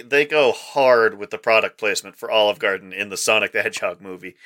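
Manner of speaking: ordinary delivery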